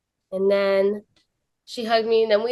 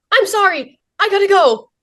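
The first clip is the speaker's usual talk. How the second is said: hurried apology; 12 semitones higher, 7 dB louder than usual. Retold or imitated. Mom hurrying off from vendor.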